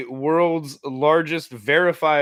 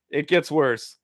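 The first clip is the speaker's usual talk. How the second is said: ordinary talk, nothing marked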